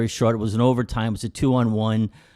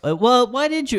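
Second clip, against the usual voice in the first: high, louder mock reporter voice; pushy reporter; 6 dB louder than usual. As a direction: needle him like a smug interviewer. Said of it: "and he goes".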